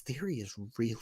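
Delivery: hushed, voiceless whisper. Real, not acted. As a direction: whisper it under your breath. Whispered aside praising a wrestler.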